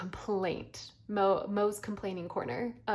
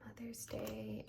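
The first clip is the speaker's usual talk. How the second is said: whispering; 10 dB quieter, slower than usual. Real, not acted. Reading envelope label.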